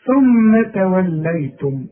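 slow ثم توليتم, mim held nasal; held ghunna. Shown as a demonstration. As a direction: stretch the ghunna on ثم. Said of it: "لازم يغني بمقدار حركتين".

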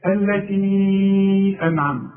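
التي drawn out very slowly for four counts; long madd. Shown as a demonstration. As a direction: hold التي for four beats before أنعمت. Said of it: "تمد أربع حركات". (مد التي أربع حركات ببطء شديد)

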